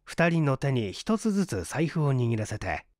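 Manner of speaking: ordinary delivery, nothing marked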